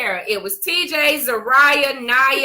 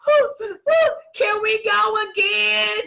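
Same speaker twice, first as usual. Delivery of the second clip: put-on high, breathless child's plea between sobs; a hint of a bawling kid begging for more; imitating crying daughter; 8 semitones higher, slower than usual. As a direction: squeak it out like a little girl still crying. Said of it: "She was like".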